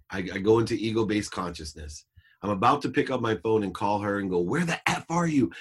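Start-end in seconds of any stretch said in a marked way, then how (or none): 4.4-5.5 high, raised-pitch demand; mock screaming like a maniac; imitated angry yell. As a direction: blow up at her, half-contained. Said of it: "screaming like a maniac".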